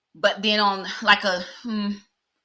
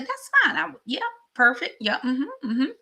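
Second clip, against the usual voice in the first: much higher, eager but acting casual; squeaky eager yes; 7 semitones higher than usual. Agreeing to a first date, squealing high with excitement. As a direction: squeal it while pretending to be cool. Accepting date, playing it cool.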